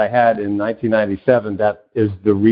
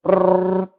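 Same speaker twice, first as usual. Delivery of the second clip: computer whirr noise; 6 semitones higher than usual. Retold or imitated. Brain searching its files.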